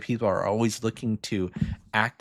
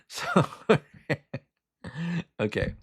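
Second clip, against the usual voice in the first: laughing; 6 semitones higher than usual. Real, not acted. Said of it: "laughs at own voice".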